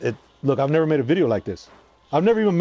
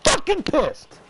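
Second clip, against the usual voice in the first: pissed off; 13 semitones higher, much slower than usual. Real, not acted. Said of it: "I'm so".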